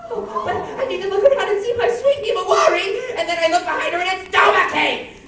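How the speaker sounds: high, loud panicked anime voice; Watanuki freakout. Acted. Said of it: "a Watanuki freakout".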